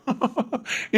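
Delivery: quiet chuckling; amused laugh, well under the talk; soft laughter. Acted. Brothers joking together. (அமைதியான சிரிப்பு)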